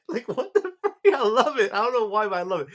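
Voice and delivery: high, loud laughing outburst; cracking up in delight; laughing disbelief. Real, not acted. Reviewer reacting to funny scene.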